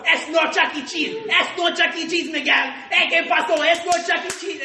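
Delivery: put-on high, loud accented rant; imitating the boss. The boss ranting at him about kids, high and loud. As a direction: hammer the line over and over like the boss. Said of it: "he goes".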